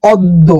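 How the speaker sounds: অদ্য said "od-do", doubled d; pronunciation demo. Shown as a demonstration. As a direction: say অদ্য alone, as "od-do". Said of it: "অদ্য শব্দের উচ্চারণ হয়েছে".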